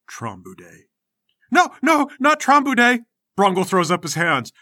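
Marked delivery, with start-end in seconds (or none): 0.1-0.8 low, much softer mutter of a verdict. Acted. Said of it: "then mutters". 1.5-3.0 high, loud frightened cry; panicked plea. Acted.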